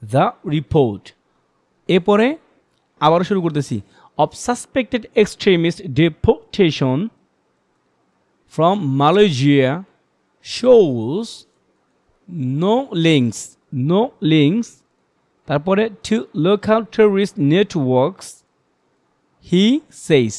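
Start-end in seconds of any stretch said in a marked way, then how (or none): 0.0-1.0 "The report" drawn out very slowly, then a pause; slow chunk. Shown as a demonstration. Teacher models a pause after the subject, slow. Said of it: "একটা step পরে একটু দম নিচ্ছি". 4.2-20.3 very slow, with a clear pause after each chunk; pausing at each step. Shown as a demonstration. Teacher shows pauses at each step for students, slow. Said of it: "প্রত্যেকটা স্টেপে আমি কিন্তু pause নিচ্ছি".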